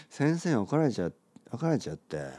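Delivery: slack 「先生怒られちゃう」 without opening the mouth; lazy mumble. Shown as a demonstration. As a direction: say it sloppy with lips barely open. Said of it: "だらしない感じでね、口を開けずに".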